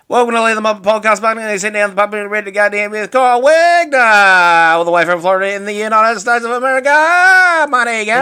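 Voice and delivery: loud, fast, high hammed-up broad Australian accent; broad Aussie. Host turning up the Aussie for his cohost, loud broad Australian. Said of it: "turn up the Aussie to five? Or maybe even ten".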